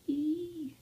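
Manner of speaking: high strained squeak; tense squeak. Real, not acted. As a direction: squeak tensely while concentrating. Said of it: "sorry about the sound effects".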